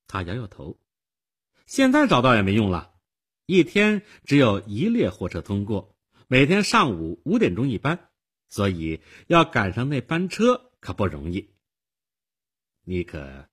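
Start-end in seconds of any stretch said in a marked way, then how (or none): 1.7-11.5 lightly voiced girl, higher and louder; a hint of a know-it-all girl; matter-of-fact girl. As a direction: explain it briskly, like a knowing young girl. Voices the girl explaining trains.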